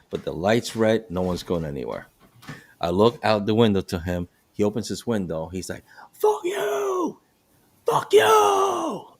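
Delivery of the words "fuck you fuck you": imitated furious shout, strained and very high; angry yelling driver. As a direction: yell it like an enraged motorist, high-pitched. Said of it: "he's yelling at me".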